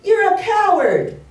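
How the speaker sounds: high, loud, drawn-out taunt; boy yelling. Retold or imitated. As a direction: shout the insult slowly into the forest. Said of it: "he yells out".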